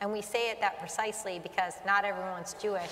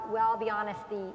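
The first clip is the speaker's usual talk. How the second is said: normal talking voice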